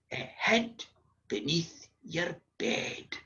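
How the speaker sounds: slow, hushed, spaced-out punchline; granny's ominous reveal. Retold or imitated. Granny frightens children in bed, slow hushed reveal. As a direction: draw it out softly to scare. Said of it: "my granny looked at us and said".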